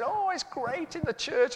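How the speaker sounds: high, slow gushing wife voice; lightly mock his wife's delight; wife's glowing report, done high and slow; imitating his wife. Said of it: "She used to come home and say".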